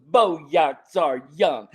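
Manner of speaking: very slow, drawn-out taunt; imitating a cheeky kid making fun; mocking swagger. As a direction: parrot it back word by word, cocky and teasing.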